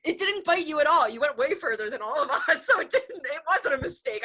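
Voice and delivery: amused, insistent, giggling; laughing reassurance. Real, not acted. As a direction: laugh your way through the protest. Teasing friend, laughing through it.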